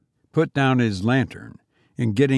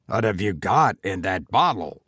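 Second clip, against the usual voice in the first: low menacing goblin; 5 semitones lower than usual. A narrator's reading. Goblin voiced in story.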